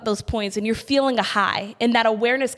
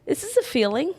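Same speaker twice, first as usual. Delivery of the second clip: pep talk; 6 semitones higher than usual. Retold or imitated. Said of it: "give yourself a pep talk".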